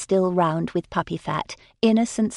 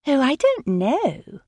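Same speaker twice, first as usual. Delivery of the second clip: higher, slow coy demurral; a lightly voiced bashful young lady; 8 semitones higher, slower than usual. A narrator's reading. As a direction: play at modesty, fishing for praise. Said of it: "Jane had mastered bashfulness".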